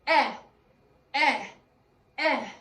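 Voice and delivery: short-E "eh", punched three times; letter-sound demo. Shown as a demonstration. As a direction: say "eh" as a loud isolated vowel. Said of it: "and she says".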